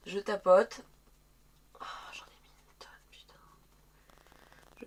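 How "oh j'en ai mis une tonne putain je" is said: quick whispered aside. Real, not acted. Muttering to herself after overdoing the product, whispered. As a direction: hiss it under your breath, fast. Reacting to too much product.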